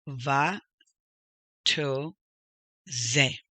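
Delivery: va, to, ze, each set apart; teacher's careful split of va-to-ze; syllable by syllable. Shown as a demonstration. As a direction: sound out VA, TO, ZE one at a time. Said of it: "that's where the emphasis goes".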